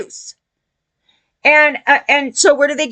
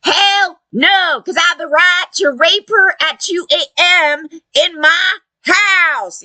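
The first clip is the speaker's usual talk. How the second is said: raised-pitch mock belligerence; mocking impression of indignant entitlement; mimicked defiant men; 4 semitones higher than usual. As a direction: imitate them digging in, hitting each phrase. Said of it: "Yeah, that's them".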